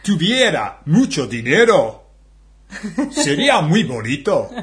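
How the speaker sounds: Spanish sentence in a hammed Glasgow Scottish accent. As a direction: get into a Scottish mind and speak in a Glasgow Scottish accent. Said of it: "esa frase en escocés".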